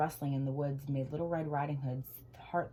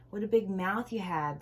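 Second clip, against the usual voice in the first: ordinary delivery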